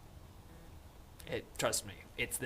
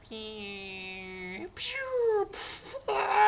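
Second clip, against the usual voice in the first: high whistling falling-plane noises; imitated plane crash; 9 semitones higher, much slower than usual. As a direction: mimic a shot-down plane with drawn-out sound effects.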